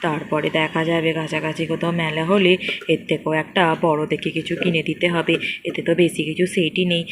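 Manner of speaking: low, grown-up father voice, a bit harried; deep fatherly voice muttering about the hassle. Acted. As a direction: speak as a busy dad talked into an errand. Father character returns with toy set.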